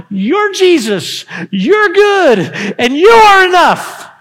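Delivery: starts lifted and ends in a full shout on you are enough; a Gentile woman to Jesus, rising to a cry; building to a shout. Acted. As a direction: build her confession until it bursts out loud.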